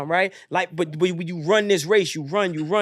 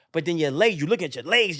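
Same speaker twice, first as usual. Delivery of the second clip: ordinary talk, nothing marked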